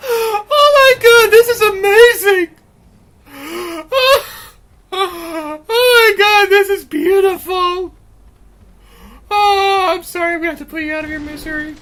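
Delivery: high squealing laughter through words; laughing so hard it cracks; hysterical laughing. Real, not acted. Glitch makes him crack up.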